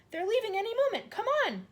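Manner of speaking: put-on high loud child; urgent shout. A narrator's reading. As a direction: hurry her along. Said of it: "she shouted".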